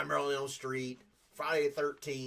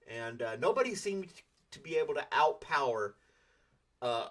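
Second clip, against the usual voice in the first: normal talking voice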